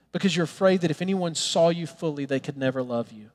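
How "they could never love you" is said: ordinary talk, nothing marked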